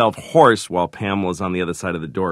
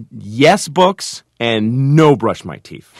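loud, higher toddler mimicry, slow at the end; imitating a defiant toddler; 5 semitones higher, 8 dB louder, much slower than usual. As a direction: declare it like a small child just learning to talk. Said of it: "like a monkey ... that just learned to talk".

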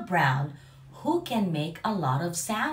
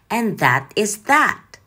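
lively, sing-song picture book reading; animated storytime voice for young listeners; reading to children; 8 dB louder than usual. Acted. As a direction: read it out like storytime for little kids.

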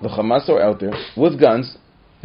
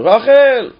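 higher, louder call across the house; mother calling out; 11 semitones higher, 7 dB louder than usual. Retold or imitated. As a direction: call a daughter to help. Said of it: "she says to her".

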